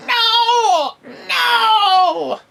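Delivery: high, loud, drawn-out wail of protest; villain's cry. Acted. Sorcerer sees spell shaken off, high wailing shout. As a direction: wail it in hammed-up dismay. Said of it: "And he's like".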